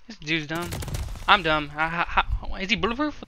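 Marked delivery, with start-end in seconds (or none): none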